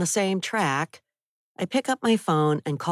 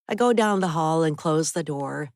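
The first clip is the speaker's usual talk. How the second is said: usual speaking voice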